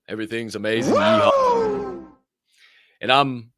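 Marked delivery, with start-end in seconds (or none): 0.9-2.0 high loud yeehaw shout; cowboy whoop. Real, not acted. Celebrating the win, whooping yeehaw. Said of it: "Feeling awesome".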